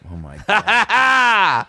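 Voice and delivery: slow laughing oh my God; laughing disbelief. Real, not acted.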